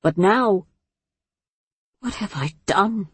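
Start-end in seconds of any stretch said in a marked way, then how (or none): 2.0-3.1 quiet, stricken self-question; soft dismay. A narrator's reading. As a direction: murmur it, shaken. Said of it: "Zan asked herself".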